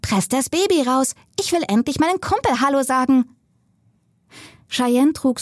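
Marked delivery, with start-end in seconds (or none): 0.0-3.2 high, squeaky teddy bear voice. Acted. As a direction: squeak it like a talking toy. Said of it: "sagte mit quiekender Stimme".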